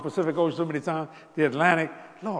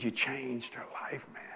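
soft slow whisper; quiet awed whisper; whispered thanks; 4 semitones lower, 8 dB quieter, slower than usual. Retold or imitated. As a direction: hush it in awe. Husband whispering gratitude.

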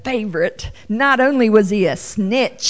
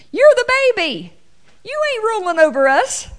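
much higher, fast scornful voice; scornful sibling; 11 semitones higher, faster than usual. Pretend. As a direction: put the baby brother in his place. Brothers mocking the youngest.

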